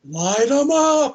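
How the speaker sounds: drawn-out, high, loud command; retold rallying shout. Retelling the torch scene in the woods, rallying shout. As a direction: call it out long, swelling high.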